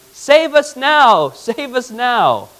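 high, drawn-out cry for rescue; loud, stretched plea, easing on the repeat; crowd's plea. Retold or imitated. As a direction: call out slowly like a pleading crowd. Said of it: "they're saying".

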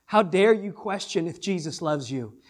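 ordinary talk, nothing marked

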